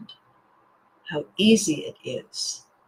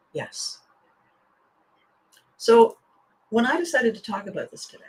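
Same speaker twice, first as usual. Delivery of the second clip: usual speaking voice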